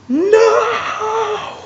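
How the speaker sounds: very high, strained cry of no; anguished cry. Retold or imitated. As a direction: scream it like watching someone fall. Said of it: "I'm like".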